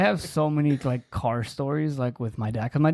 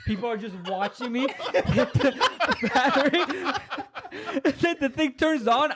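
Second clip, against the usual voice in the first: high squeaky laughter through words; laughing while telling; 9 semitones higher than usual. Real, not acted. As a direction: crack up mid-story. Laughing at himself hitting battery.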